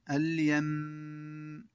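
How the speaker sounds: اليم stopped slowly on a long hummed م; ghunna at the stop. Shown as a demonstration. Teacher shows ghunna on the stop. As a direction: close اليم with a long nasal م. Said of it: "الوقف على النون والميم المشددتين بالغنة".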